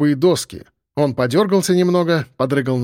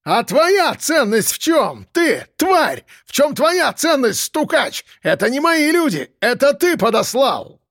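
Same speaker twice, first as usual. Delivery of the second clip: high-pitched furious tirade; yelled accusation; 10 semitones higher, slower than usual. A narrator's reading. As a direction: hurl accusations in an outraged high voice. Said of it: "заорал он".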